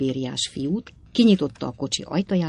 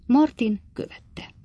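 usual speaking voice

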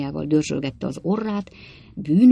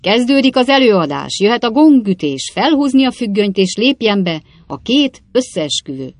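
higher, louder theatrical announcer voice; showman's announcement; 5 semitones higher, 7 dB louder than usual. A narrator's reading. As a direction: proclaim it like a fairground showman. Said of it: "mi alatt így szónokolt". (színpadias, kikiáltói szónoklás)

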